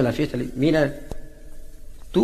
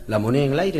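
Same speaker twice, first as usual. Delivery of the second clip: ordinary talk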